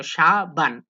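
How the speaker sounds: সাবান said as shaban with sh; সাবান with a sh, not an s; teacher repeats সাবান as shaban; pronunciation demo. Shown as a demonstration. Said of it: "সাবান উচ্চারণ হবে তালব্য শ মতো".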